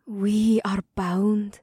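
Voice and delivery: slow, soft and tender. Acted. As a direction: murmur it softly, lovingly. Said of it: "said in a soft voice".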